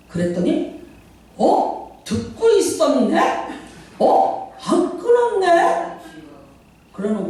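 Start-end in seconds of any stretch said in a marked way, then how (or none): 1.4-5.9 high, drawn-out astonished voice; mock surprised drunk man. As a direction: act amazed he never hung up. Drunk caller surprised pastor listened. (전화를 안 끊은 목사에게 놀라는 취객)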